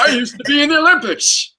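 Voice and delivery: high, put-on old man voice; old man jock. Co-host imagining an old man jock, old man jock voice. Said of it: "imagine an old man jock".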